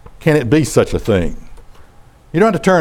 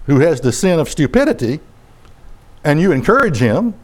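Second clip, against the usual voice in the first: ordinary delivery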